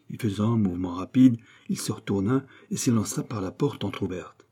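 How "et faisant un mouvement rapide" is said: ordinary delivery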